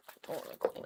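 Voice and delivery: whispered, unwilling; reluctant whisper. Real, not acted. Dreading the cleaning, a whispered whine. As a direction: hush it, sulking about chores.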